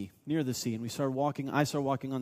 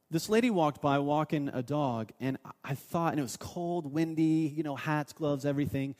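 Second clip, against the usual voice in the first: ordinary talk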